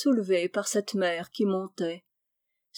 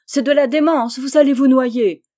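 loud, high cry of despair; desperate shout; 4 semitones higher, 10 dB louder than usual. A narrator's reading. Chabre warns the lovers of drowning, desperate loud cry. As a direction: throw the warning out in despair. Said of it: "geste de désespoir".

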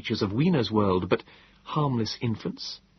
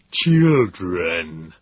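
louder Morlock word; 3 semitones lower, 6 dB louder than usual. A narrator's reading. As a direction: repeat the word with weight.

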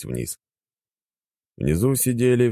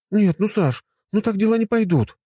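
upset; 3 dB louder than usual. Acted. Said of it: "искренне расстроившись".

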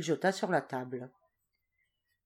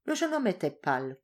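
usual speaking voice

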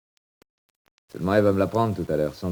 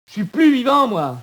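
très aigu et fort; cri déchirant; 7 dB louder than usual. Acted. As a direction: hurle que tu n'es plus vivant. (cri aigu désespéré)

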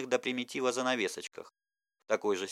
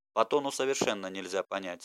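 ordinary delivery, nothing marked

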